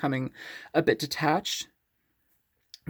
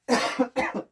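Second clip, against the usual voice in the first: sharp dry cough; real cough from dust in the throat; 9 semitones higher than usual. Real, not acted. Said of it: "it's like dust in my throat".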